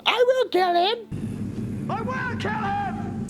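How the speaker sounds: overacted high yell of rage; hammy villain yell. Reviewer mocks [name]'s only line in the knife fight, hammy high yell. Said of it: "a fucking [name] from Man of Steel".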